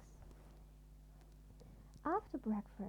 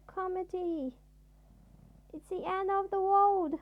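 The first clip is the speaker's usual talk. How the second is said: high, loud wailing panic; hysterical panic; 9 semitones higher, 10 dB louder than usual. A narrator's reading. Timid Mister Jelly, sure the house is falling, panicking loudly. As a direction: shriek that the world is ending.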